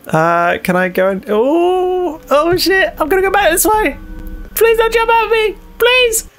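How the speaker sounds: normal talking voice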